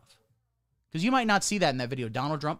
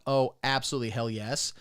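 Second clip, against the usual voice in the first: ordinary talk, nothing marked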